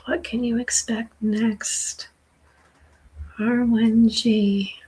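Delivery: ordinary talk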